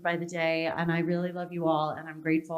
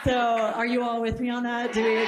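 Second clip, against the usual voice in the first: excited; 5 semitones higher, 4 dB louder than usual. Real, not acted. Rallying the audience.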